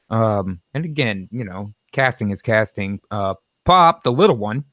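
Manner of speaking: ordinary talk, nothing marked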